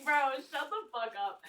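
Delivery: loud, drawn-out joking shout at a coworker; mock exasperated retort. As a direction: playfully yell down a teasing coworker. Acts out retort to teasing coworker.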